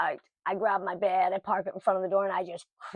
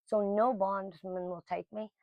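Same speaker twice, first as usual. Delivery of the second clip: ordinary talk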